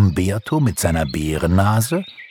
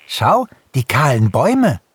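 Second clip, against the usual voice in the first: higher, louder hare's call; 8 semitones higher, 5 dB louder than usual. A narrator's reading. Hase Langbein points out bare trees to friends, calling out. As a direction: call out in a raised voice. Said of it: "rief Hase Langbein".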